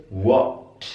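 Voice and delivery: British 'what' with rounded o, wot; British pronunciation. Shown as a demonstration. Teacher contrasts accents for students, British pronunciation. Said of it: "Kalau British".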